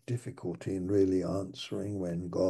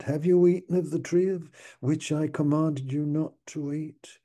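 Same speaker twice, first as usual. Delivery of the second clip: God's voice; 6 semitones higher, 4 dB louder than usual. Acted. God questioning Adam.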